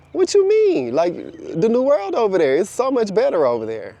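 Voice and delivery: high-pitched, baffled pushback; acted astonished persuading; incredulous reply. Acted baffled reply, selling new world.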